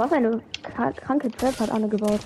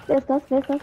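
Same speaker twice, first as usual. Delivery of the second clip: ordinary delivery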